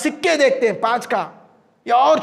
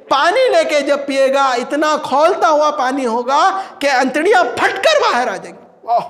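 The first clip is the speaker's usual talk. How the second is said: usual speaking voice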